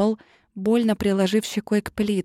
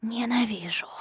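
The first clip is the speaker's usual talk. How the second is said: breathy villain's whisper at the neck; menacing whisper; 3 semitones higher, 7 dB quieter than usual. A narrator's reading. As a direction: hiss it right into her ear. Злодей шепчет героине. (угрожающий шёпот)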